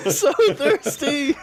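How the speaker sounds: high, slow, drawn-out whine through laughter; laughing complaint. Real, not acted. Host needing a drink, laughing complaint. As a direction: stretch the words while laughing.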